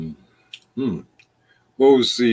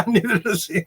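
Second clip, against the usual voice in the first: laughing; 10 semitones higher, 3 dB louder than usual. Real, not acted. Laughing correction of a friend.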